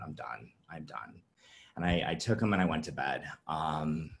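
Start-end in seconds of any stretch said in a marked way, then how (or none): 0.0-0.5 low, hushed, and drained of fight; a soft, defeated murmur to herself; quiet resignation. Retold or imitated. Telling a support group of the night she gave up, hushed. Suicidal thought voiced quietly. 0.7-1.2 breathy final whisper, barely voiced. Reliving the moment she gave up, whispered to herself.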